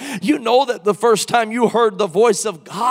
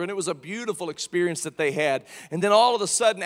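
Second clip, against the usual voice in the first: ordinary talk, nothing marked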